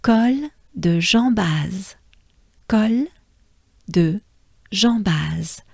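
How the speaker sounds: Col de Jambaz, slow, nasal jam; French Col de Jambaz. Shown as a demonstration. Teacher spelling out the pass name Col de Jambaz. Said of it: "they will arrive in".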